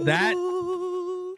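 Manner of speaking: high-pitched. Acted. Mock reaction.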